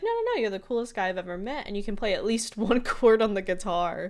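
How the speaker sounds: imitating an excited Mabel; gushing girl. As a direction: gush like a smitten teen. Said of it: "She's like".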